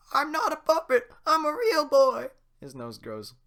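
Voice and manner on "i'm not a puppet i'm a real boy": put-on very high, loud puppet boy; Pinocchio. Pinocchio protests to the guard, very high and loud. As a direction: insist you're real.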